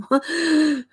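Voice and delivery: short high-pitched laugh; real burst of high laughter; amused laugh. Real, not acted. Streamer amused by a viewer's sock drawer comment, high laugh.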